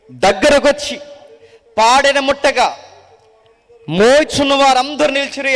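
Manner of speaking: slow, loud, measured Bible reading; scripture reading. Acted. Preacher reading the Gospel to the congregation, slow and solemn. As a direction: read the passage solemnly and slowly. Reads scripture passage aloud.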